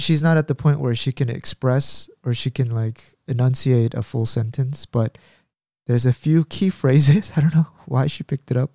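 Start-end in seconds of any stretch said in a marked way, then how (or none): none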